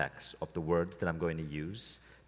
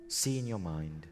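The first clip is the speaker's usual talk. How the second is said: guided meditation. Acted. Leading a room into a relaxed state, guided meditation. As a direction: lead them inward, unhurried and measured.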